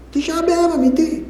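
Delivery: normal talking voice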